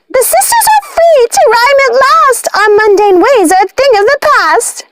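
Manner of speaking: high chanting witch voice, triumphant; high sing-song witch chant; rhyming witch. Acted. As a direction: crow the rhyme like a witch set free. Martha stamping, rhyming at last.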